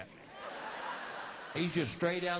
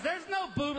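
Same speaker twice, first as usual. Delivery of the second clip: put-on high-pitched excited kid's remark; excited boy; 11 semitones higher, 3 dB louder than usual. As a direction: blurt it out high, acting the son. Child's excited remark retold.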